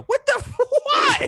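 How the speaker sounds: very high, laughing squeal; laughing disbelief. Real, not acted. Reacting to the dad's tornado run, laughing disbelief. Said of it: "outburst laughing".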